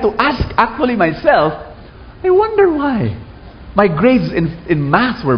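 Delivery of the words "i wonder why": puzzled wondering. Retold or imitated. Wondering aloud, retold.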